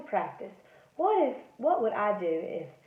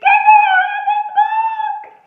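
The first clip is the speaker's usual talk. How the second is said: very high, loud shriek; terrified scream; 15 dB louder than usual. Acted. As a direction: scream in panic to escape. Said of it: "and I screamed".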